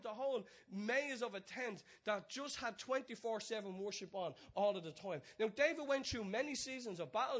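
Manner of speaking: high, loud, driving sermon delivery; rising pulpit fervour; full-force preaching. Acted. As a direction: preach it out to the whole room.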